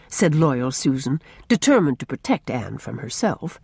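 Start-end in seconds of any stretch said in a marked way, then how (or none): none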